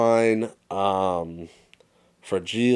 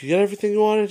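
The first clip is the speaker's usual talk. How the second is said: much higher, louder teasing dad voice; dad asking; 13 semitones higher, 5 dB louder, much faster than usual. Retold or imitated. Dad to disappointed Ralphie, a much higher, louder teasing voice. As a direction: play the sly dad. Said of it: "His dad's like".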